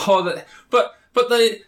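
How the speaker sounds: high, giddy, stammering rush; babble, too wired to think; adrenaline high. Acted. Juggler high on applause, pleading to go back, giddy and high. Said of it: "on a massive adrenaline high".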